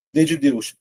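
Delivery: ordinary talk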